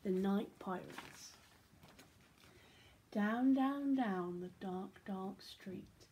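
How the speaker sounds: put-on lively read-aloud for children; animated storybook reading; story narration. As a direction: tell it like a bedtime picture book. Reads picture book aloud.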